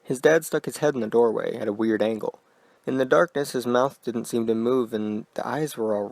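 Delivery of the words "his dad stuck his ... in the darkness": ordinary delivery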